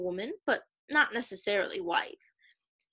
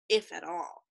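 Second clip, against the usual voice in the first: ordinary delivery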